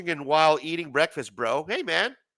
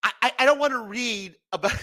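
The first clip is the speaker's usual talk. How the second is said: laughing; 6 semitones higher than usual. Real, not acted.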